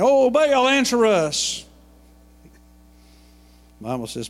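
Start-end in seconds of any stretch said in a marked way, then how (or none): 0.0-1.6 high, loud cry to a silent god; pleading shout. Retold or imitated. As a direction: cry out desperately to Baal.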